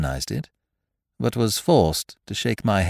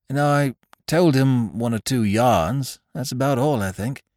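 higher, brisk English officer voice; young soldier; 3 semitones higher than usual. Acted. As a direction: chat obligingly as a young captain.

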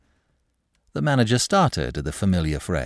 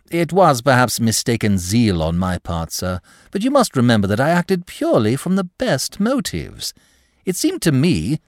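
higher-pitched earnest pleading; suave, glib clerk; 7 semitones higher, 4 dB louder than usual. Acted. Clerk excuses his zeal to the manager, glib. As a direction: explain yourself with eager, airy politeness.

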